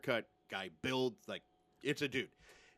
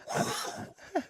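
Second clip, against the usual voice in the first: burst of laughter. Real, not acted. Laugh before punchline.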